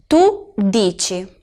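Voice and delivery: slow, dici said as DEE-chee; careful pronunciation. Shown as a demonstration. Teacher repeating dici for learners, slow and clear. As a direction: stretch dici so ci sounds chee. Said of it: "La C y la I es ci".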